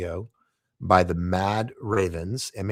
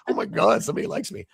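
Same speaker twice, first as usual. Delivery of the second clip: put-on higher, giddy teenage gush; pent-up high school excitement; 12 semitones higher than usual. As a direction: squeal it like a teen who just got noticed. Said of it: "pent up high school".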